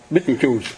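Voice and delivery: Betelgeuse drawn out as "beetle-juice"; American mispronunciation. Shown as a demonstration. Teacher recalling a scary film's title, slow American "beetle-juice". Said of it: "người ta đọc, đọc là gì quý vị?".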